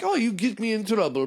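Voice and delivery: high, prissy robot voice; high worried droid complaining; fussy droid. Pretend. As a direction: fret like a nervous droid. C-3PO voice.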